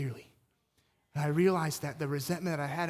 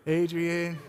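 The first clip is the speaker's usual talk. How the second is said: loud mock Rocky call; hammed-up boxer's cry; Rocky impression; 6 dB louder than usual. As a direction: call out like a slurring movie boxer. Quoting Rocky's famous cry.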